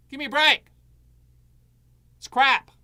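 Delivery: loud disgust at the sales pitch; fed-up outburst. Real, not acted. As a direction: throw it away with contempt. Dismissing the agent's pitch.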